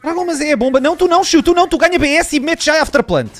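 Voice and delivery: high urgent shouted calls to the team; excited callout. Real, not acted. Gamer yelling the plan to his teammates, urgent high callout. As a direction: fire off the plan fast and high.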